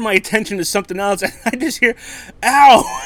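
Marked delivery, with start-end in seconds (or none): 2.4-3.0 retold yelp of pain. Retold being stabbed at dinner.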